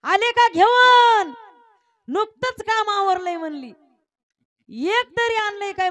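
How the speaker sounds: high, loud, slow mock-sweet nagging; hammed-up scolding housewife; sarcastic wife. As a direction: mimic a shrill sarcastic wife. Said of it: "मधुर स्वभावाची होती".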